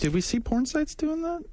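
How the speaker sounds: high, disbelieving question; surprised, pitch shooting up; incredulous. Real, not acted. Questions a claim about porn sites.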